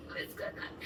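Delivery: whispered confusion. Retold or imitated. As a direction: breathe it out unsure.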